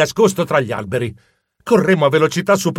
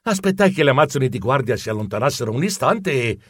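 usual speaking voice

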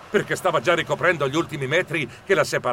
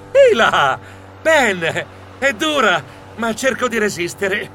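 strained, higher-pitched forced cheer; brave face under strain; 6 semitones higher, 4 dB louder than usual. A narrator's reading. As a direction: put on a brave front, starting loud. Uncle struggling on the rough crossing. (sforzandosi di resistere)

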